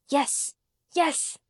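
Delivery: ordinary delivery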